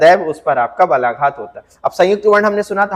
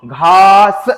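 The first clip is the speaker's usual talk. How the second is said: घास slower and a bit louder; teacher models घास with stress; stressed demo; 6 dB louder, much slower than usual. Shown as a demonstration. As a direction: draw out the long आ in घास. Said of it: "जब उच्चारण करेंगे तो".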